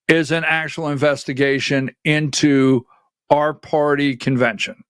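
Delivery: ordinary talk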